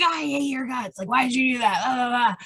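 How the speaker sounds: mock angry rant. Acting out road-rage reaction.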